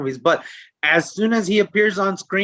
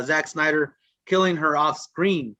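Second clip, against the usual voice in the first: usual speaking voice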